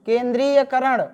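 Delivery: ordinary delivery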